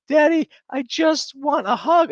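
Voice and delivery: put-on very high, loud little-girl plea; imitated screaming child. Dad imitating his daughter begging for a hug, high childish wail. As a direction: whine it way up high like a child mid-meltdown.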